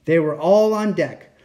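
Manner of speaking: ordinary delivery, nothing marked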